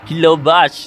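calling out. Acted. Calls the robot again. (ตะโกนเรียกหุ่นยนต์ให้ตื่น ดังขึ้นและช้า)

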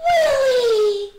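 high, loud, drawn-out cry; joyful cry. A narrator's reading. Alexander recognizes his friend, joyful cry. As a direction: cry the name in amazed delight. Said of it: "cried Alexander".

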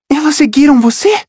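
pergunta aguda e ansiosa; nervosa. Acted. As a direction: pergunte com medo. Rachel nervosa. (Rachel pergunta a Percy, nervosa)

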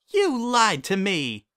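high, slow, accusing; hurt glare. Acted. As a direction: point out the betrayal slowly. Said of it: "turned her anxious smile into a glare".

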